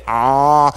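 long, steady held "ah"; open-mouthed waiting. Acted. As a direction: hold an "ah" with mouth wide open. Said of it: "he was waiting like this".

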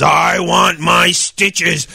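slow shouted groan; angry monster. Retold or imitated. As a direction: bellow it like a mad monster. Angry monster in retold story.

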